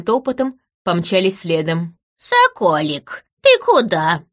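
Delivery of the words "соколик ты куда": put-on slow, disappointed old hag voice. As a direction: call after him like a sulking crone. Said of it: "Разочарование в моём голосе было искренним".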